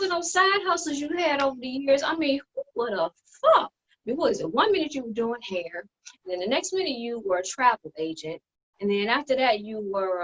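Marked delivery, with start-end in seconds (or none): none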